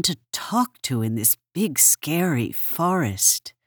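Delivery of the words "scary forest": plain reading voice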